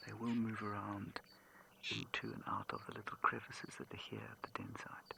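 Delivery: very soft whisper so as not to disturb the cubs; hushed den whisper. Real, not acted. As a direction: whisper low, keep it barely audible.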